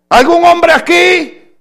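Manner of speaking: loud, high-pitched call to the congregation. Real, not acted. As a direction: shout the question out to the men. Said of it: "Tal vez lo dije muy rápido".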